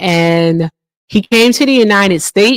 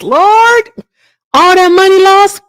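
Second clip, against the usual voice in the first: exasperated exclaim; 12 semitones higher, 4 dB louder than usual. Real, not acted. Exclaiming over lost money.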